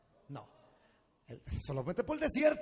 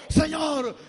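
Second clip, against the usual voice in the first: high, loud cry to God; desperate cry; 9 semitones higher, 9 dB louder than usual. Retold or imitated. Moses on his knees crying out to God. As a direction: cry out to the Lord in despair. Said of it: "Y gritaron".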